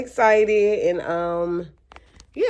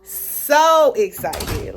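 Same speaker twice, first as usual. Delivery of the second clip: very drawn-out, louder and higher emphasis; real excitement; 4 semitones higher, 5 dB louder, much slower than usual. Real, not acted. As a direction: stretch it out loud with excitement. Repeats how excited she is.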